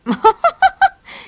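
high staccato giggle; loud, very high kingfisher chuckle; a burst of high, repeated ha-ha notes; laughing. A narrator's reading. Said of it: "se mit à rire doucement".

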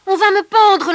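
loud, high cry for rescue; urgent call. Acted. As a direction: call out in desperation. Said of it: "lança-t-il". (appel pressant, fort et aigu)

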